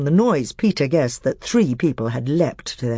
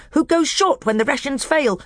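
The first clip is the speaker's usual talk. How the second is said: high, sly dwarf voice pitched well up; a bitter dwarf testing the council; high, crafty dwarf; scheming dwarf; 12 semitones higher than usual. Acted.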